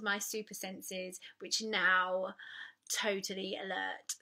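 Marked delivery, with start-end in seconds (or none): none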